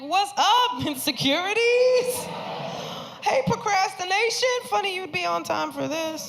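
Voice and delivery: put-on high, teasing hello to old acquaintances; mock-cheerful greeting. Greeting personified worries at night.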